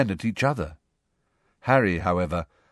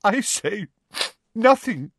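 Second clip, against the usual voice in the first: high, choked-up martyr; tearful, halting and high-pitched; 12 semitones higher than usual. A narrator's reading. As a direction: choke out the words with wounded feeling. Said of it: "she choked".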